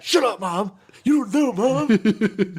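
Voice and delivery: high, loud yell, stretching out the end; bratty mock tantrum. Dependent son yelling at mom, mock tantrum. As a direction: wail it like a petulant son.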